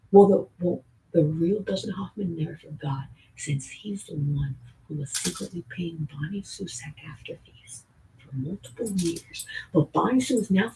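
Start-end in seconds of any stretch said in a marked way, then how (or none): 1.1-9.5 hushed whisper; whispered conspiracy aside. Real, not acted. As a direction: drop to a secret whisper. Secretive aside.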